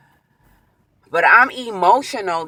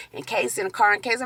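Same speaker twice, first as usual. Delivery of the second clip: ordinary talk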